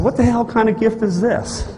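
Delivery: indignant at the strange gift; high-pitched indignant disbelief; outraged. Retold or imitated.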